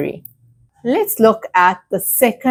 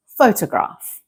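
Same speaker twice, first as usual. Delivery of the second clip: photograph stressed on PHO; careful model photograph with the beat on PHO; first-syllable stress; 3 semitones higher than usual. Shown as a demonstration. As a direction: hit the first part, PHO-tograph. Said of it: "the stress is on the first part".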